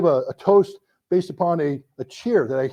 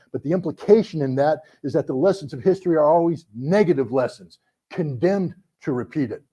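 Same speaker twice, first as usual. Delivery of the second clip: ordinary delivery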